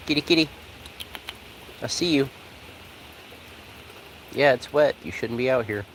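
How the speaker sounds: calling a kitty. Real, not acted. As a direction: call the kitty sweetly. Calling to a cat.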